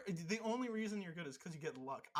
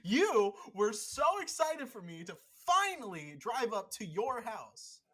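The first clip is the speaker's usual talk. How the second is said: louder, slower hammed-up heartbreak; mock emotional rant; 4 semitones higher, 7 dB louder than usual. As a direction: play the wounded friend, over the top.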